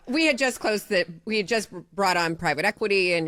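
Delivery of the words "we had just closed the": usual speaking voice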